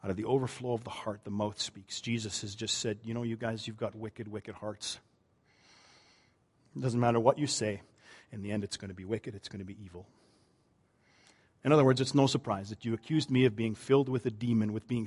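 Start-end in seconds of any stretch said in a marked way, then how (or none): 0.0-10.1 far softer, lower, intimate pulpit voice; hushed preaching. Acted. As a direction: lean in and speak it gently.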